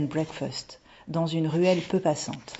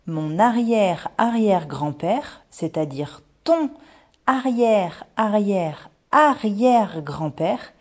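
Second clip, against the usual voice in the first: slow, loud, pitched up on each arrière; drawn-out emphasis; 5 semitones higher, 6 dB louder, slower than usual. A narrator's reading. Mother reveals the ancestor to daughter, slow and emphatic. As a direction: spell out each generation slowly for effect.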